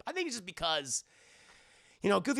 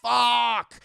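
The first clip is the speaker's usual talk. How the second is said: frustrated curse; 6 semitones higher, 11 dB louder than usual. Retold or imitated. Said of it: "And he goes".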